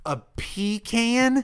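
high, drawn-out disbelief on "pecan"; slow, high incredulous question; incredulous echo. Retold or imitated. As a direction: stretch PEE-can slowly upward. Mocking incredulity at partner's pronunciation.

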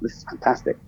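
breathy whisper to himself; whispered thought. Retold or imitated. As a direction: whisper it like a private thrill. Retold inner thought, whispered.

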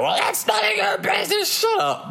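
very high squealed outburst; hysterical mock screech. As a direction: screech it like someone losing their mind. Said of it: "now you lose your fucking mind".

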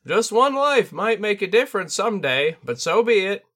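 high, loud bird spirit sensei; a lofty bird teacher, amused; Tengu voice. Acted. Tengu accepts the cost.

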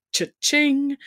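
high, sing-out cash register noise; high, playful cha-ching; gleeful cha-ching. Acted. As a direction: ring it out like a jackpot. Mimics cash register over a bonus.

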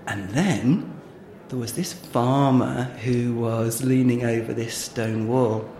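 ordinary talk, nothing marked

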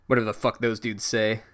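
ordinary talk, nothing marked

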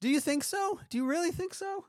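high squeaky incredulous question; mock disbelief. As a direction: ham up the disbelief. Mock disbelief at friend's claim.

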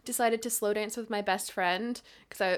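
usual speaking voice

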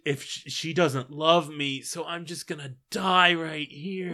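high-pitched mock despair; lovesick Romeo. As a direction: melodramatically give up on life over a crush. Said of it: "he's infatuated with this girl Rosalind".

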